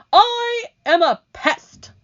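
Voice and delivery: very high, loud, slow proud cry; gleeful announcement. Acted. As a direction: crow it with glee. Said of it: "the centipede announced".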